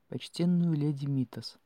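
plain reading voice

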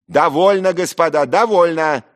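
very high, loud imperious cry; all-powerful call to stop; commanding shout. Acted. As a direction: shout down the whole table. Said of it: "закричал всевластно Зверков".

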